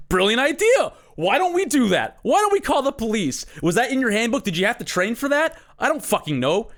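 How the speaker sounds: mock the guards with fake enthusiasm; sarcastic mockery. Mocking the hospital security guards.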